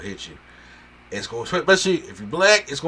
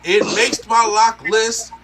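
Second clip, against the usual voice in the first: high, loud, slow gush of excitement; much higher and louder, overjoyed; 9 semitones higher, 7 dB louder, much slower than usual. Real, not acted. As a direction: stretch it out like you can't contain it. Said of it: "complete nerdgasm".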